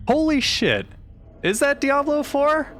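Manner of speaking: normal talking voice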